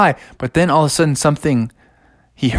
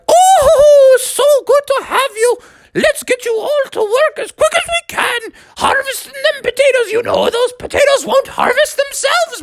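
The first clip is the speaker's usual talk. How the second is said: very high squealing auntie voice; squealing baby talk aunt. A narrator's reading. Aunt Molly voiced in story.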